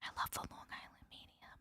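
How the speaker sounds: very soft whisper; whispered aside. Real, not acted. Whispered aside about the celebrity.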